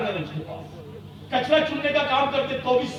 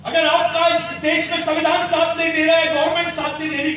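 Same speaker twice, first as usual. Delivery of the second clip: booming rousing general speech; 5 semitones higher, 5 dB louder than usual. Real, not acted. Rousing rally speech, raised voice.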